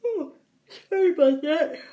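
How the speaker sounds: apology stretched by a real yawn. Real, not acted. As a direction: speak through a yawn. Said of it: "I'm yawning out of nowhere".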